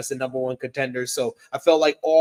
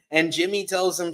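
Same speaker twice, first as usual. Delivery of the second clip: ordinary talk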